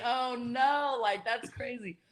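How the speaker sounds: high, soft retold dismay; mock dismay. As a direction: shrink back in a high, quiet voice. Retelling her embarrassed reaction.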